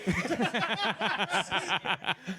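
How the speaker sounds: a long, high burst of real laughter. Real, not acted. Laughing at the recording.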